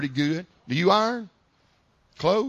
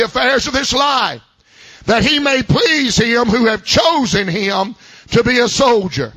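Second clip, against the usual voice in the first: scripture reading; 3 semitones higher than usual. Acted. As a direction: read the verse like a preacher building up. Reads scripture, raised preaching voice.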